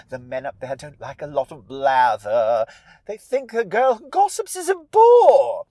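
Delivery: grand scheming villainess; Pat Carroll as Ursula. Acted. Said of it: "as Pat Carroll doing Ursula the Sea Witch".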